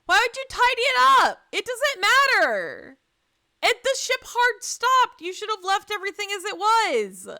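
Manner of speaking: loud, high scolding outburst; exasperated yelling. Real, not acted. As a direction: yell at the screen in disbelief. Yells at the character.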